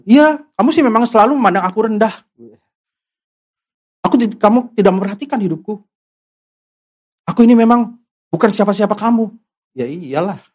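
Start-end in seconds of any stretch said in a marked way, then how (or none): 0.0-9.3 fast, hurt sulking accusation; self-pitying complaint. Retold or imitated. A hurt church member complaining to a friend, fast and self-pitying. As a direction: pour out the grievance quickly, feeling slighted. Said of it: "Kata-kata itu yang keluar".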